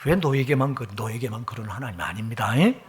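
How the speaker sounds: ordinary talk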